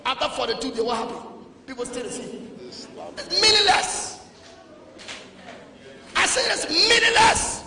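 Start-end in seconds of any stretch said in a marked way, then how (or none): 2.7-7.6 high, loud, slow scornful cry; exclaim. Real, not acted. Preacher mocking pointless fasts, high and loud. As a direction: stretch the word out in disbelief.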